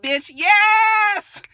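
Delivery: high, loud hype; excited cheering. Retold or imitated. Viewer hyping Portia's outfit, excited cheer. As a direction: cheer her look at full pitch.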